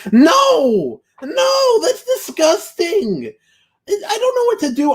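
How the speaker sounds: hammed-up tearful wail, pitched high; mock crying wail. Streamer reading a donation, mock crying and wailing. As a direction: fake sob over a disgusting donation message. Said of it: "you sound like a crybaby right now".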